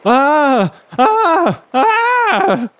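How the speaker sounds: very high-pitched frightened cries; acted terrified "ah" screams; crying out in terror. A narrator's reading. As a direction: cry out in panic, voice shooting up. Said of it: "crying out".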